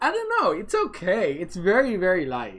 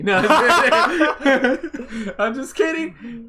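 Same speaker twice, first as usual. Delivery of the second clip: laughing; 8 semitones higher, 8 dB louder, much slower than usual. Real, not acted. Laughing takeback of joke.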